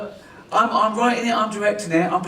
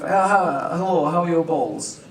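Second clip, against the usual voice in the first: put-on stupid Croatian accent, Russian-sounding; mock Croatian accent; slower than usual. Director teasing his half-Croatian actor in a silly mock Croatian accent. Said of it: "We just did stupid accents".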